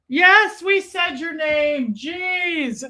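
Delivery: high, playful scolding with a loud first word; mock exasperation. Mock-scolding a viewer during shout-outs.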